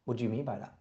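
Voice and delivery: quiet, gently probing; understated soft probing question; soft curious question. Shown as a demonstration. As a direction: ask it softly, with real curiosity. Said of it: "my favorite question".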